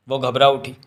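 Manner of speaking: usual speaking voice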